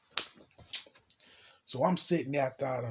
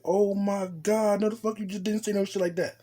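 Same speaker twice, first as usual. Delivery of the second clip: mock shock; 5 semitones higher than usual. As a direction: imitate her stretching out the shock. Girl's shocked reaction, retold.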